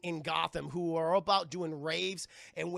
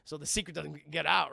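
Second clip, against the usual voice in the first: usual speaking voice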